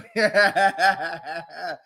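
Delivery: short laugh. Real, not acted. Laughing at the coach names, short chuckle.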